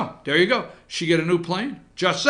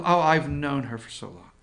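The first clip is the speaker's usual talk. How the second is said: normal talking voice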